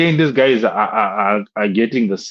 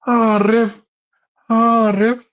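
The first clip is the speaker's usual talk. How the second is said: high-pitched imitation of a whining player; mock moaning; 12 semitones higher, much slower than usual. Fan mimicking a player moaning to the referee, mock whine. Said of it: "he's moaning to the referee".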